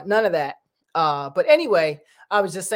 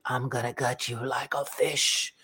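low, slow, quieter menacing threat; a creepy subway stranger promising violence; 7 semitones lower, 6 dB quieter, slower than usual. Retold or imitated. Vlogger imitating the subway stranger, low and menacing. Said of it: "He's like".

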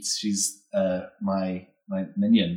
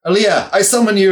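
commanding summon; 10 semitones higher, 10 dB louder than usual. Acted. Lich summoning minion.

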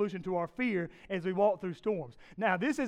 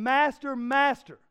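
yelling; 5 semitones higher, 6 dB louder, slower than usual. Retold or imitated. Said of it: "they wake him up yelling".

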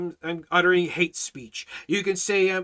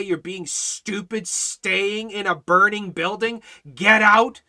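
slow, rising to a loud yell of get out; urgent warning shout; 4 semitones higher, 6 dB louder, slower than usual. Pretend. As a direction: yell at them to get out of the burning house. Yelling at neighbor in burning house.

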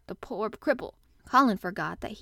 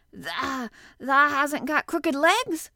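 higher, louder, drawn-out hoarse question; hoarse quaver; 5 semitones higher, 7 dB louder, slower than usual. A narrator's reading. Old gardener asks about the boy's legs, hoarse quaver. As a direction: quaver it out slowly in disbelief. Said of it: "quavered Ben more hoarsely yet".

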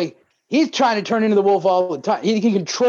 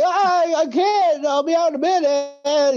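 put-on much higher, hidden teen voice stalling through a door; panicked teen; 9 semitones higher than usual. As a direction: play the kid hiding in the bathroom, pitched way up. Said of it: "And he's like".

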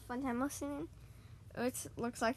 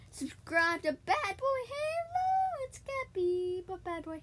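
much higher, slow, drawn-out hype; hyped plug; 10 semitones higher, 4 dB louder, slower than usual. Real, not acted. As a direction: sell the channel like an announcer.

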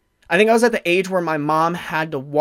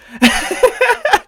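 high bursting laugh; real burst of laughter; 5 dB louder than usual. Real, not acted. Laughing at his own airport blunder, high laugh. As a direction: crack up at the memory.

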